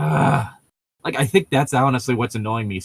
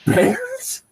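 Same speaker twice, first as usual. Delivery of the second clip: very high gasped "Bears?" rising sharply; gasped question. Retold or imitated. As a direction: gasp "Bears?" as a shocked question, pitch soaring. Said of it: "gasping the word bears as a question".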